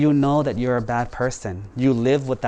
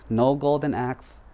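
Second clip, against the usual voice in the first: ordinary delivery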